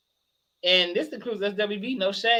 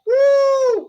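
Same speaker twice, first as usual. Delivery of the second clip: high whoop; 9 dB louder than usual. Real, not acted. Laughing after retelling insult.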